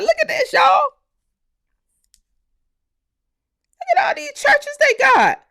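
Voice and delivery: high-pitched self-quote; retold exclamation. Quoting himself on the live, high excited call. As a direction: point it out, pitched well up. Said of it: "I was like".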